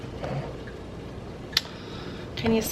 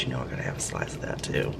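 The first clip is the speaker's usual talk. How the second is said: whisper; 10 dB quieter, faster than usual. Real, not acted. Whispering over the cut tomato.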